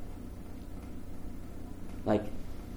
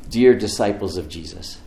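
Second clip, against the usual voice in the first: ordinary talk, nothing marked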